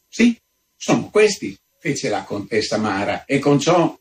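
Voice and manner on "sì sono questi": countess voiced higher, a little indignant. A narrator's reading. Countess answers the visitor, indignant and higher. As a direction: answer him as the offended countess.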